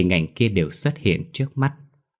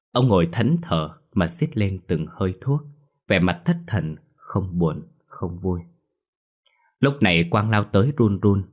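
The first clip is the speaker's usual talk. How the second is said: plain reading voice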